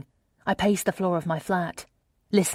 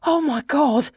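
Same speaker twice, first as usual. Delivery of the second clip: high, louder tearful exclamation; acted sob of shock, pitched high; incredulous sobbing burst; 8 semitones higher, 6 dB louder than usual. A narrator's reading. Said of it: "incredulous bursts of".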